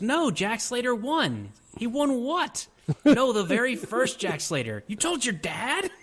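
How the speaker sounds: high, slow put-on voices; hammed-up kid and captain voices; acted movie exchange. Back-and-forth voices retold.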